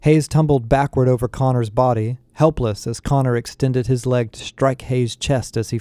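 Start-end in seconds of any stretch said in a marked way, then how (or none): none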